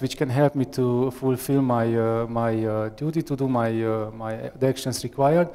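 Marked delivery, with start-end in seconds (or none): none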